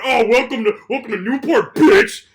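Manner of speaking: loud, laughing bully's welcome; sneering teen bully greeting the new kid; mock jock taunt. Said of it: "Luke is sitting around going".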